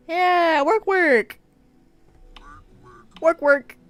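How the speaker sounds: high, loud game-voice catchphrase; Warcraft catchphrase. Acted. Streamer repeating a game line for laughs, loud catchphrase. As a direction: holler it high, playfully.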